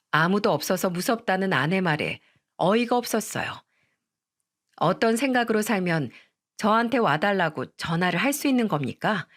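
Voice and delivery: ordinary delivery, nothing marked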